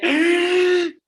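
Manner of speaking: high, loud mock scream of a frightened parent; short, very loud staged scream; panicked scream. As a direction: let out a hammed-up shriek of alarm. Desperate parent seen by child.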